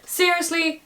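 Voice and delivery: high, incredulous snap; fed up with her coming back; a sharp, disbelieving outburst; exasperated Congal. A narrator's reading. Congal furious at returning wife.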